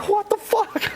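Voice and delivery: a high, shocked yelp; startled. Retold or imitated. Soldier pelted by monkeys, in a high startled yelp. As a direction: yelp it as the shit starts flying. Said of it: "And I'm like".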